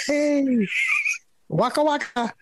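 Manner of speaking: high, jokey stretched "hey"; goofy, high-pitched friendly hello; overly nice greeting. Retold or imitated. Hamming up a nice greeting to the tutor, high. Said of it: "I was trying to be as nice".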